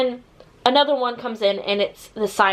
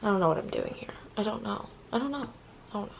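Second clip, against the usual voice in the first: whispered, lost aside; 12 dB quieter, faster than usual. Real, not acted. Reviewer losing her thread, whispering to herself. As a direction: whisper to yourself, unsure what you're doing.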